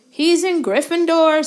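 high, loud hasty reply; quickly. A narrator's reading. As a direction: blurt it out fast. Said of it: "said Harry quickly".

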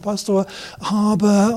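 mock doubting believer. Pastor mimics a doubter objecting to him, whiny and slow. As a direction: whine it like a faint-hearted churchgoer. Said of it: "diesen kleinmütigen Zweifelgeist".

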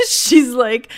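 loud, high laugh breaking out; burst of laughter. Real, not acted. Vlogger laughing at the fast food insult, loud high laugh. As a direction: crack up at the jab. Laughing at the Taco Bell jab.

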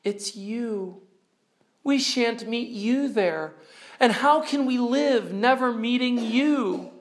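high, plaintive reading; storybook sorrow without real sobs; distressed Lucy. A narrator's reading. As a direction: plead in a high voice, lightly acting upset. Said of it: "sobbed Lucy".

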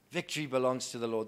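ordinary talk

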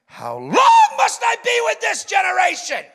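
high, loud, fed-up bellow; a big, hammed-up cry of frustration; exasperated shout. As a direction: yell it like a teacher at the end of his rope. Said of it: "he didn't say it like that".

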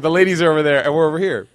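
put-on quick, loud, coaxing the boy along; coaxing explanation. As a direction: rattle it off to a small child. Coaxing son, fast and loud.

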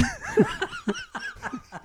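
high burst of laughter. Real, not acted. Host breaking up over botched singing, laughing. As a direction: crack up helplessly.

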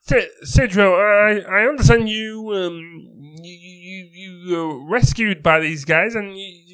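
high, hammed-up put-on accent; Sildar's accent. Game master voicing Sildar greeting the rescuer, high put-on accent. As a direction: pitch up and push the silly accent. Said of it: "that blooming accent of his".

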